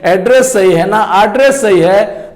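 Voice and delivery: ordinary delivery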